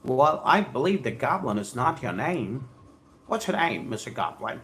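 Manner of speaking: ordinary talk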